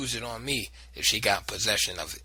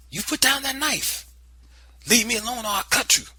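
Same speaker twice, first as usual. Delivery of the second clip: high, loud threatening yell; scream; 13 semitones higher, 5 dB louder than usual. Acted. Boy threatening aunt with knife, screaming. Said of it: "I screamed".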